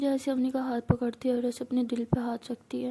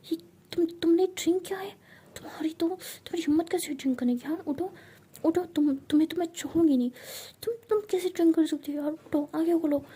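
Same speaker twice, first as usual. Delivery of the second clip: angry scolding; 5 semitones higher than usual. Acted. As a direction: scold her, fed up. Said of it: "बहुत गुस्सा कर रही होती है". (दोस्त को डांटती हुई, गुस्से में)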